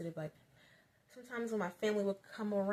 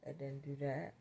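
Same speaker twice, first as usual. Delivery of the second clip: usual speaking voice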